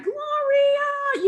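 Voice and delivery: Italian-style name, sung out high; mock Italian. Mimicking Italian friend Jessa calling her name, high and lilting. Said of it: "she's Italian".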